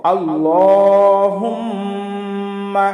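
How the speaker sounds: slow chanted tajweed recitation; Quran recitation. Shown as a demonstration. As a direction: recite it drawn out and melodic. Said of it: "এইভাবে পাঠ করব". (ধীরে সুরে তিলাওয়াত)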